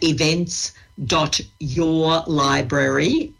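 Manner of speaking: "your library" drawn out very slowly; slow dictation. Real, not acted. Dictating a web address for listeners.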